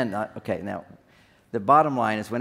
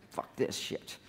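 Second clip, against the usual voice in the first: near-whispered acted curse; muttered walkout; 13 dB quieter, slower than usual. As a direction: mutter it under your breath as you give up. Acting himself walking out on tension.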